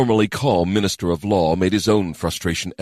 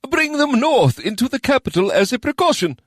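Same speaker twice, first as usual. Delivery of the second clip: anxious plea; 4 dB louder than usual. Acted. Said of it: "anxiousness in the ... quivering voice".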